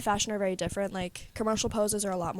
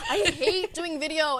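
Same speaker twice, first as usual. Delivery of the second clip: slow, laughing complaint; 6 semitones higher, slower than usual. Real, not acted. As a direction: drag it out through a laugh.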